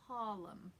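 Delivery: "Harlem" said HAW-lem; New York "Harlem" with the R gone; dropped R. Shown as a demonstration. Teacher repeats the example word, dropped R. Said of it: "no R in the word Harlem".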